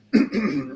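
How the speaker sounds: short, rough throat clear. Real, not acted. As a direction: clear your throat as your voice gives out. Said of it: "I'm losing my voice here".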